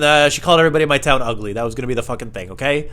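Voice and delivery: ordinary delivery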